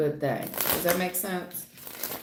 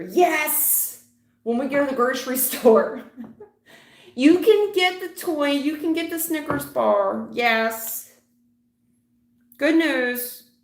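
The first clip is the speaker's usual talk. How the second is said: put-on high, loud, laughing kid cheering for a treat; mocking the spoiled kid in the grocery store, excited and loud; 6 semitones higher, 5 dB louder than usual. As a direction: play the child who just got the toy. Mocks a spoiled kid's treat.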